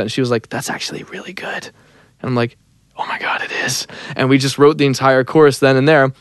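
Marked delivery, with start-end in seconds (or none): 0.5-1.6 whispered imitation of a co-writer; hushed amazement. Retells co-writer's awed reaction. 3.0-3.9 awed retold whisper; whispered realisation. Retells his own awed realisation.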